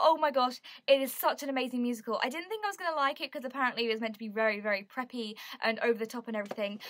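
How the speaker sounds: ordinary talk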